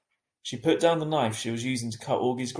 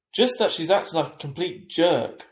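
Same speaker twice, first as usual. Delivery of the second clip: bitter complaint; 5 semitones higher, 6 dB louder than usual. A narrator's reading. Complaining about Miranda.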